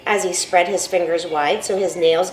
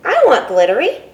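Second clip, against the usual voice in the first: put-on eager child; 5 semitones higher, 6 dB louder than usual. Child demanding glitter.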